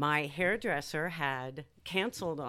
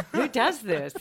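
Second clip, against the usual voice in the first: laughing; 6 semitones higher, 7 dB louder than usual. Real, not acted. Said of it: "I just started laughing".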